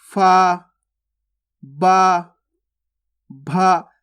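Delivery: each letter drawn out alone, long pauses between; वर्ग का पाठ. Shown as a demonstration. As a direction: recite the syllables one by one for a class. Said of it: "अब क्या पा वर्ग होता है ना". (एक-एक अक्षर, रुक-रुककर)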